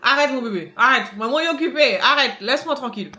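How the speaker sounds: loud, high laughing protest; laughing scolding. Real, not acted. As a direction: shout stop through laughter. Scolding child pulling mask.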